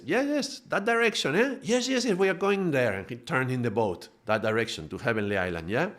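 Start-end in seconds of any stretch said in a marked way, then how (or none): 0.0-2.9 much higher, eagerly agreeing; happily nod along, fully convinced; relieved captain. Retold or imitated. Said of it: "the captain likes".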